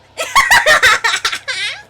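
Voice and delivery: loud high villain cackle; character cackle. Acted. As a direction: throw back a manic laugh. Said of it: "I haven't done that in so long".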